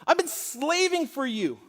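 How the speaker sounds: put-on older son scolding his father outside the party, resentful and loud; resentful anger. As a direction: throw the grievance at your father. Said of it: "you could hear his resentment and his anger".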